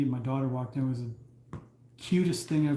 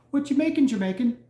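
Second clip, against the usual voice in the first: put-on high, bright-eyed kid asking innocently; mock little girl voice, loud and high; 9 semitones higher, 5 dB louder than usual. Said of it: "She's like".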